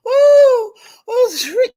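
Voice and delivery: high, delighted oohs; laughing whoop. Real, not acted. Cracking up over Strictly's arrival.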